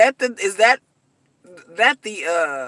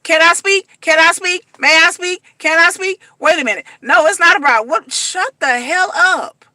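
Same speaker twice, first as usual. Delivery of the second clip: Kimberly insisting; 5 semitones higher, 4 dB louder than usual. Retold or imitated. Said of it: "She's like".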